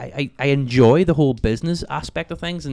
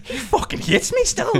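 incredulous. Retold or imitated. Disbelief at neighbour's stare.

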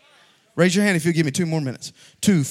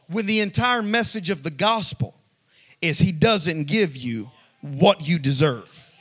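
normal talking voice

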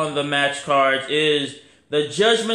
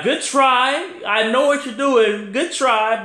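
high, slow and mocking, good try; 8 semitones higher, 3 dB louder than usual. Mocking the wrestler's fake brother trick, high and slow. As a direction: tease him, dragging out good try.

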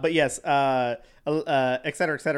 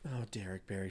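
whisper; 8 semitones lower, 14 dB quieter than usual. Acted. Reverent reaction to the name.

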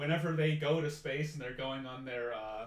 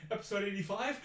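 pitched-up, alarmed echo question; rising alarm; 6 semitones higher than usual. Retold or imitated. Actor recognises the fatal episode, rising alarm. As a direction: imitate dawning panic, ending on a rise. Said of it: "like, a freaking panic attack".

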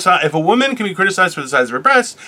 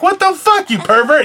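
a much higher, mock-shocked yelp; mock outrage; 9 semitones higher than usual. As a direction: squeak it out like you're scandalized. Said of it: "I'm like".

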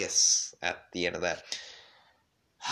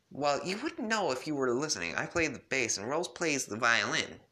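put-on a higher teen girl voice; girly voice; 6 semitones higher than usual. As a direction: pitch up for Jade. Jade voiced again.

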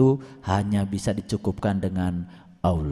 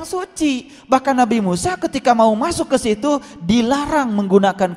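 high-pitched, insistent preaching; impassioned preaching; 13 semitones higher than usual. Real, not acted. Preacher explaining the holy place to congregation, impassioned.

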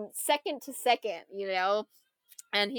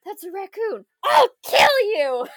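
panicked scream; 10 semitones higher, 7 dB louder than usual. Retold or imitated. Kevin Bacon yelling at Rocket the raccoon, panicked. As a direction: scream as if a raccoon just talked to you.

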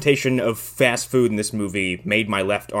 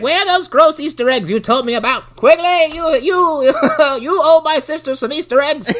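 put-on little girl; 5 dB louder than usual. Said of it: "She's like".

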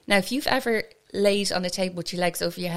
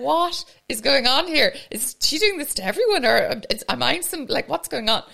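higher, louder retold panicked questions; bewildered disbelief; 8 semitones higher, 6 dB louder than usual. Asking herself during the waxing, bewildered disbelief. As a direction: play up baffled inner alarm. Retold horrified inner thoughts on table.

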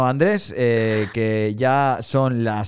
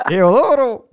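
calling out; 10 semitones higher, 7 dB louder, slower than usual. Acted. Playful calling out a name.

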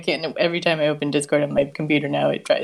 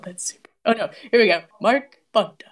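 usual speaking voice

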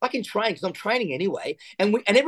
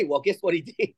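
normal talking voice

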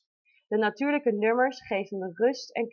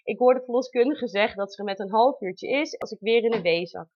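ordinary talk, nothing marked

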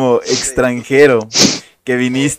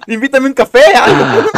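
much higher, playful mock request; mock buddy voice; 10 semitones higher, 4 dB louder than usual. Retelling a pal asking for a coffee, high mock voice. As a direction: put on a cheeky pal's high voice. Mimicking classmate's reaction.

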